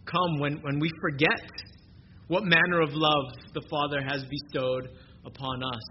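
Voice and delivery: normal talking voice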